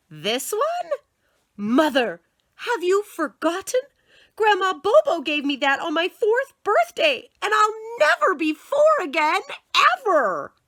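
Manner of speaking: put-on high, loud melodramatic child pleading; dramatic child. Boy to mother over a birthday toy, high loud melodrama. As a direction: overplay it like a tragic little actor. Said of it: "Don't be so dramatic".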